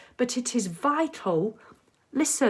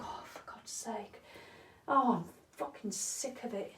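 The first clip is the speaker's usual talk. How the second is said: fed-up whisper under the breath; grumbling to herself about her bad back; whispered grumble; 11 dB quieter than usual. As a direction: hiss it to yourself, sick of the pain. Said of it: "I go".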